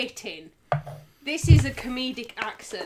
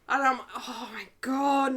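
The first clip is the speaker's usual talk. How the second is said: usual speaking voice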